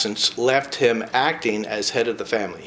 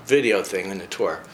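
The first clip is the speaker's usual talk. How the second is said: ordinary talk, nothing marked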